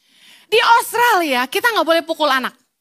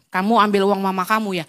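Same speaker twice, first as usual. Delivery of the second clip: put-on a lower, accusing shopkeeper asking the child; shopkeeper's voice; 10 semitones lower than usual. Shop owner questions the little girl, low and accusing. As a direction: drop low like a suspicious old shopkeeper. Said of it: "Jadi dia bilang gini".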